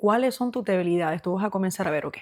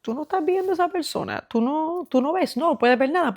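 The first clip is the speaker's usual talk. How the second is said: much higher put-on voice of a warning friend; people warning you; 7 semitones higher than usual. Friends warning someone blinded by emotion, mimicked in a much higher voice. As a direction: mimic the friend who warns you, pitched way up. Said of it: "la gente te dice".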